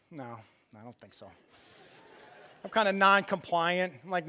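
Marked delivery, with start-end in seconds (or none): 0.1-1.3 quiet refusal. Retold or imitated. Dad refusing the therapist.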